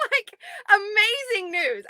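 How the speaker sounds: slow, high, delighted gush. Real, not acted. Delighting in the news with followers, gushing. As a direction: savor how amazing it is. Celebrating good news with viewers.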